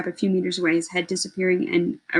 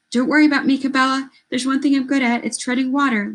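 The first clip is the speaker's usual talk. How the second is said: yelled reassurance; 7 semitones higher than usual. A narrator's reading. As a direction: shout to calm her from the water. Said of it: "Lito yelled back".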